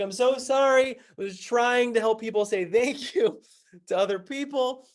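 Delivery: high-pitched, flustered mock apology; hammed-up desperate apologizing; pleading apology. Pleading apology to accusing crew.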